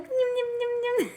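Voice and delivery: put-on high cooing baby talk at a stroller; high-pitched nyum nyum baby coo.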